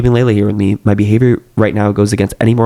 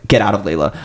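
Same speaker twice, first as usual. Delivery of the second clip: plain reading voice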